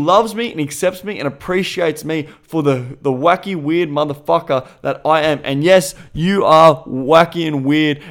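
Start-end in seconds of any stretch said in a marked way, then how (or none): none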